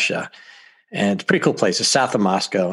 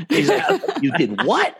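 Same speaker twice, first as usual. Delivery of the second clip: laughing. Retold or imitated. Quoting the driver's shocked reply.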